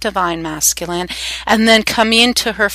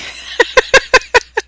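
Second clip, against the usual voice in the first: sudden high bright burst of laughter; real laugh. Real, not acted. Laughs at accent teasing remark.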